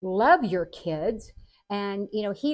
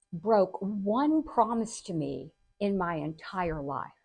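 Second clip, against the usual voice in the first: normal talking voice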